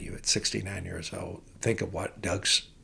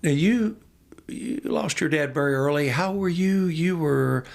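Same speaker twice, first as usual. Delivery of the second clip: loud questioning; 5 semitones higher, 4 dB louder than usual. Retold or imitated. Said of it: "he goes".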